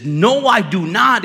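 loud, high, drawn-out refusal; emphatic denial. Acted. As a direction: deny it forcefully, stretching the words. Peter angrily denies knowing Jesus.

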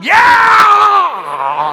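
loud high gung-ho yell; eager shout. Retold or imitated. Brainwashed son shouting yes to his mom. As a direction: shout it like a pumped recruit.

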